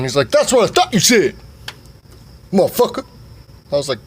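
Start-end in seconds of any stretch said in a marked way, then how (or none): 0.3-3.0 dad voice. Retold or imitated. Dad's triumphant comeback.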